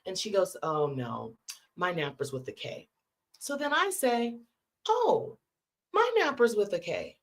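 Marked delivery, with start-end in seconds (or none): 0.6-2.9 low, understated correction; matter-of-fact correction. Retold or imitated. Friend correcting the spelling of her family name, matter-of-fact. 4.9-7.2 retold burst of surprised recognition; astonished realization. Teller finding her Knappers have a K too, astonished.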